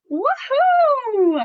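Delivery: high, loud, stretched woohoo; big excited woohoo. Shown as a demonstration. Reading big letters to kids, a loud excited cheer. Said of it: "say it with a lot of emphasis".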